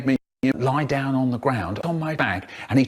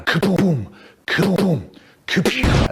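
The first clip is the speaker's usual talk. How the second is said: imitated booms, pitched up; mock explosion sound; 4 semitones higher, slower than usual. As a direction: kids playing at explosions, popping each "kaboom". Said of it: "we're all going".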